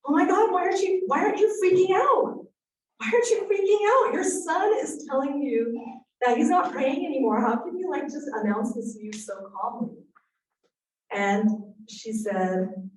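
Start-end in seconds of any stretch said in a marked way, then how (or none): 0.0-10.0 high, loud panicked questions; young mom freaking out at the news; alarmed blurt. Retold or imitated. Young mom blurting out panic at calm friend. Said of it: "I just kind of blurted out".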